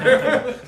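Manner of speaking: loud high laugh; real loud laugh. Real, not acted. Speaker with the audience, loud high laugh. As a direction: burst out laughing.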